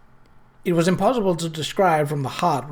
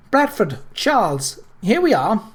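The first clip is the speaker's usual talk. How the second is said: calling out; 4 semitones higher than usual. A narrator's reading. Calling to men on platform.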